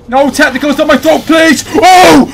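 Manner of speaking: high, loud, fast pleading; frantic plea rising to a shout; panicked begging. Real, not acted. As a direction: beg fast as the tentacles come at you. Grabbed by tentacle monster.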